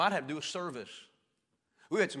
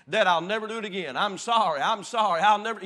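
put-on loud, higher, begging child's wail; hammed-up tearful begging child, louder and higher; mock crying plea; 7 semitones higher, 13 dB louder than usual. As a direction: wail it like a scared kid before a spanking. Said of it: "I said those words".

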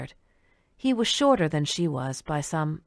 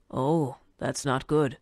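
put-on slow, lower old man; 4 semitones lower, slower than usual. Old man voiced by narrator.

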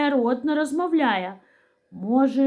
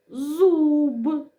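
зуб with a long, higher У; drawn-out single syllable; 5 semitones higher than usual. Shown as a demonstration. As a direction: stretch зуб slowly through its vowel. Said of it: "Прикладаємо тильну сторону руки".

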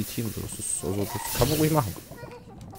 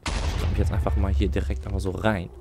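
ordinary talk, nothing marked

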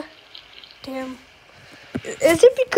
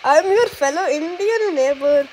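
put-on Indian accent. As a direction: play a friendly neighbour with an Indian accent. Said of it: "I'm gonna act as Indian".

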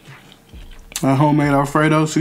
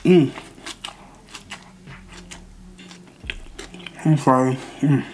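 chewing sandwich; mumbling while eating; mouth full; 2 semitones higher, 6 dB quieter than usual. Real, not acted. Seen 1: bites sandwich at start, chews with full mouth throughout.

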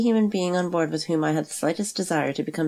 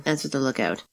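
ordinary delivery

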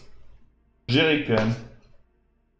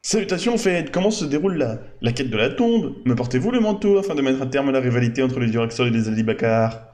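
bad acting; 2 semitones higher, 4 dB louder than usual. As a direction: overplay it like a bad stage actor. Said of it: "Notre ami le mauvais acteur, je joue mal".

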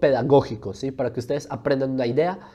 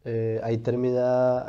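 usual speaking voice

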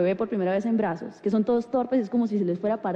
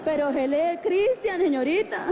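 high, slow insistence; mock-exasperated correction; exasperated customer; 5 semitones higher, slower than usual. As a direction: plead each word out, pitched up. Exasperated argument with clerk.

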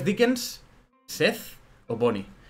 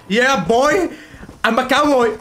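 shouted hammed-up cowboy cry, much higher and louder; mock cowboy; 11 semitones higher, 9 dB louder, much slower than usual. Streamer mimics a meme rider in the mall, loud mock cowboy yell. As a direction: yell it like a goofy cowboy on horseback. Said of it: "En plan de".

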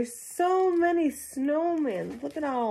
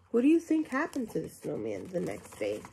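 usual speaking voice

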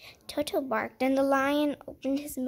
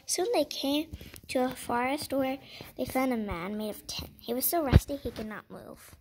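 usual speaking voice

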